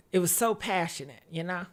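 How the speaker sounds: low, soft, tender remembering; wistful and quiet. Real, not acted. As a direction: say it softly, lost in memory. Wistful memory of lover.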